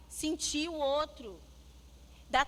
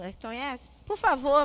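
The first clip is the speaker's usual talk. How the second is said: normal talking voice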